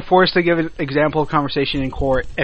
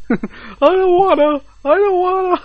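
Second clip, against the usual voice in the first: put-on very high childlike protest; hammed-up high whimpering refusal; mock pleading whine; 13 semitones higher than usual. Censor refusing to hear more podcast, mock pleading whine.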